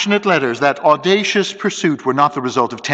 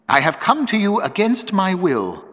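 abrupt; 6 semitones higher than usual. A narrator's reading. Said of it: "she said abruptly".

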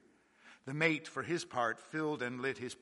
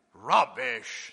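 doubtful; 2 semitones lower, 8 dB louder than usual. A narrator's reading. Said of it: "with a mixture of unbelief and doubt in his voice".

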